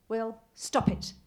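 loud, slow New Zealand-free scold; sharp scolding. Acted. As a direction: snap the order to stop. Mother scolding son's outburst.

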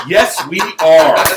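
slow, loud, drawn-out agreement; acted emphatic acceptance; emphatic yes. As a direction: land each word slowly and firmly. Eager deadpan answer to invitation, retold.

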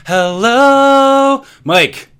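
high, loud echo of the hype; mock hype greeting. Cohost answering Mike's hyped greeting with a high mock shout. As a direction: throw the hype right back.

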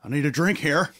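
Nicolas Cage. Acted. Host jokingly impersonating an actor for his guest, movie-star impression. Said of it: "That's my Nicolas Cage impersonation".

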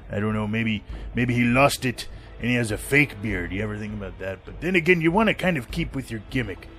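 ordinary talk, nothing marked

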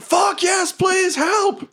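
very high, strained yell; acted panicked creature begging for rescue; desperate shouted plea. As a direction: scream back in frantic agreement. Hobgoblin yells back for help.

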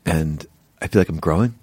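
low, halting mock sincerity; imitated show contestant. Mocking what viewers get on the show, low imitated contestant. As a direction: lightly mimic a contestant, pitched low. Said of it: "is the person going like".

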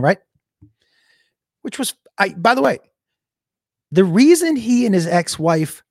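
normal talking voice

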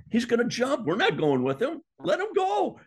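high, loud frantic protest; panicked shouting. Retold or imitated. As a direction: refuse in a panic, voices rising. The group panicking on the plane.

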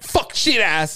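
loud, high rapid-fire swearing outburst; sudden yelled swearing; mock cursing tic. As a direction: blurt curses out as a comic tic.

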